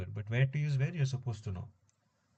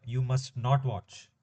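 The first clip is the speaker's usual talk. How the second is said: slow dictation; slower than usual. Shown as a demonstration. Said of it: "if I'm conjugating must".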